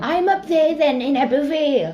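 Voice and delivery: loud, high Valleys Welsh impression; high Valleys accent. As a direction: join in with an exaggerated Valleys accent. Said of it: "the Valleys accent is more high".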